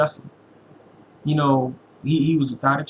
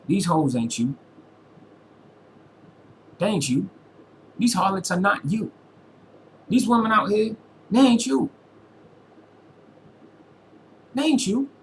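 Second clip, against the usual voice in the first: rising emphatic plea; 6 semitones higher than usual. Real, not acted. Rising pleading to mother figure.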